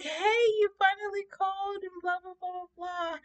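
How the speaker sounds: very high, hammed-up gushing; super excited. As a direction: gush in a squealy girlish thrill. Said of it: "I'm super excited".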